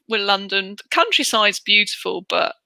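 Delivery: ordinary talk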